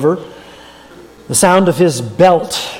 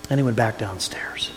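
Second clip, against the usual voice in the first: whispered, much softer ending; hushed whisper; 7 semitones lower, 12 dB quieter than usual. A narrator's reading. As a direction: let the scene fade to a whisper. Quiet close of the story.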